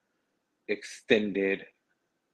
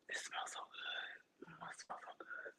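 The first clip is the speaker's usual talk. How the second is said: whispering; 25 dB quieter than usual. Real, not acted. Whispering to viewers over the smell of food. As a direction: whisper it like a secret treat.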